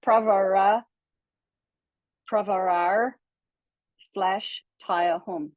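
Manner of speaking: "pravara" drawn out slowly with a v; slow syllable demo. Shown as a demonstration. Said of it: "instead of wa, it's v".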